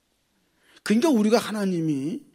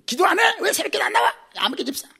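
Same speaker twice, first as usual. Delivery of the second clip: mock scolding, higher and sharper; pastor scolding; 8 semitones higher than usual. Pastor scolds a deacon who skips dawn prayer, in a hammed-up rebuke. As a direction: imitate a pastor nagging a deacon. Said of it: "뻑하면 야단 치는 사람이 있어".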